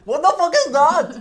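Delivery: loud, high mock British yell; hammed-up British accent; imitating British accent. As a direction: copy the angry English bloke. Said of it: "J'essaie de t'imiter".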